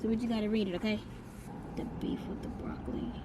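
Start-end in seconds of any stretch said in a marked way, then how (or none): 1.8-3.1 whispered menu reading; whispering. Real, not acted.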